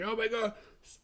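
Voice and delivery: high-pitched imitation of panic; mock freak-out. Courtney runs downstairs to report a death, mock panic. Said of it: "whenever somebody freaks out in this movie".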